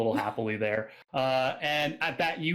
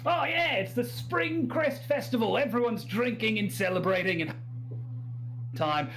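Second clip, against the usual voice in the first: high, excited Australian reveller; Australian accent; 10 semitones higher than usual. Acted. Drunk local tells newcomer about the festival, rowdy Australian accent. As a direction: crow it like a tipsy Australian at a party. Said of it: "that's my Australian accent".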